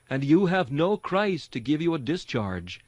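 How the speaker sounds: ordinary talk, nothing marked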